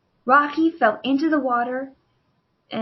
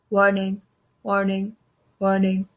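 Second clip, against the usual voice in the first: lower, even robot warning voice; robot alarm; 4 semitones lower than usual. Acted. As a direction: deliver like a machine alert. Robot's red alarm flashes.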